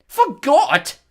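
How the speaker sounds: usual speaking voice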